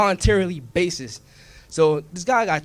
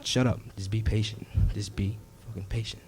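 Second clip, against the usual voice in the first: low, soft, hushed warning; hushed veteran; 6 semitones lower, 10 dB quieter than usual. Acted. Veteran cop shushing the rookie, low and soft. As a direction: keep it down, stakeout hush.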